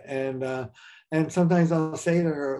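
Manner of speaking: ordinary talk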